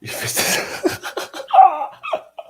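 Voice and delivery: high wheezing laugh; loud burst of squeaky laughter; helpless laugh. Real, not acted. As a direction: crack up in a high, breathless wheeze.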